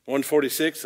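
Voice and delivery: ordinary talk, nothing marked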